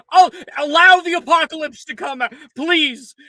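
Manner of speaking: high, loud grand decree; haughty mock permission. Player granting the apocalypse, pompous loud decree.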